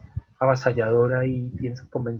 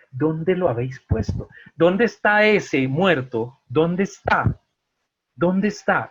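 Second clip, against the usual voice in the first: raised, emphatic searching questions; insistent questioning; 5 semitones higher, 5 dB louder, slower than usual. Acted. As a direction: press the question, voice lifted. Voices Jesus asking for the dead. (preguntando con insistencia)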